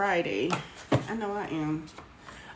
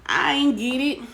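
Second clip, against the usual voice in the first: higher and louder, playful disbelief; emphatic repeat; 5 semitones higher, 6 dB louder than usual. Real, not acted. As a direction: throw "ain't" up high, loud and bright. Repeats louder after filtered comments.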